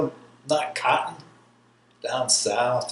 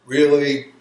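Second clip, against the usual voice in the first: ordinary talk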